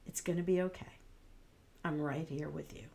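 soft, low, steady comfort; calm a scared friend quietly; calm reassurance. Shown as a demonstration. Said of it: "That's much more calming".

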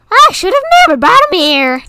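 high, loud, fast anguished cat wail; storybook cat laments bringing the prince; cat crying out. Acted. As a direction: cry it out like a frantic cat. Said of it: "the cat cried out".

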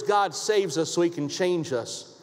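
normal talking voice

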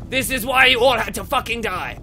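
loud play-acted scolding; mock-angry outburst. Scolding the enemies just killed, mock-angry. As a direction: burst out in mock fury. Rebukes slain game enemies.

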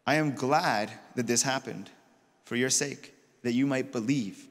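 slow, low, measured quote; solemn pronouncement. Acted. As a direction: deliver it gravely, with pauses. Jesus' grave words quoted.

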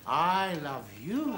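"I love you" slow and low, lifting on "you"; rising inflection. Shown as a demonstration. Boss showing another inflection, "you" rising at the end. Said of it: "depends on the inflection".

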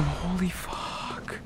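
soft, slow, drawn-out exhale of words; high, hushed disbelief; shaken relief. Real, not acted. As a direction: let it fade away slowly. Relief after narrow fight.

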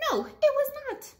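high, loud emphatic answer; indignant story answer. Acted. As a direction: insist with a big high no.

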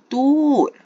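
loud annoyed dude; frustrated shout. Real, not acted.

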